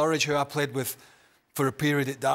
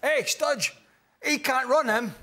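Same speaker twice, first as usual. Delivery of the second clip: mock taunt; 8 semitones higher, slower than usual. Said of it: "retold taunt".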